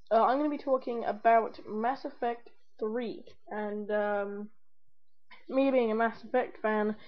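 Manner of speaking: ordinary delivery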